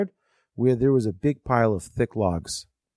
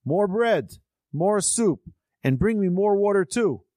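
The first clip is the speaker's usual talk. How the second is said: higher, demanding yell for more food; hungry drifter yelling at his host for more; 8 semitones higher than usual. A narrator's reading. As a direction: bark it out like a greedy, starving man. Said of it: "He banged on the table and yelled".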